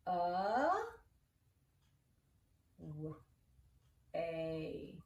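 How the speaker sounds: A, W, A as isolated sounds with long gaps; sounding out. Shown as a demonstration. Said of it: "write that one one more time".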